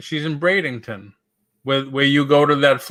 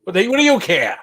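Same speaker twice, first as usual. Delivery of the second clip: high gruff Boston retort; gruff Bostonian; 6 semitones higher, faster than usual. Retold or imitated. Boston local brushing off a greeting, gruff and high. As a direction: snap it back like a Bostonian who doesn't care. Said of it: "You won't get that in Boston".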